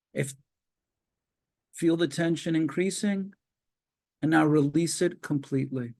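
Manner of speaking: calm, measured relaxation guide; walk them through tensing and releasing, unhurried; guided relaxation. Acted. Leads progressive muscle relaxation.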